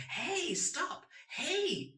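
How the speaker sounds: "Hey" high and falling sharply; emergency hey. Shown as a demonstration. Warning a car driving towards a cliff, "Hey" as a high, falling emergency call. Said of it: "It is an emergency".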